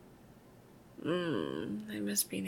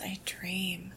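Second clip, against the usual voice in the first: usual speaking voice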